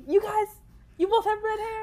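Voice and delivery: high, loud mock surprise; mock-surprised question. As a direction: poke fun at the silly question. Mocking people's remark.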